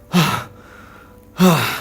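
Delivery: lightly acted panting, then talking on; out of breath. A narrator's reading. Dodi tells his wife of his escape, mildly out of breath. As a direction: open on two puffs, mildly winded. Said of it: "dengan nafas yang terengah-engah".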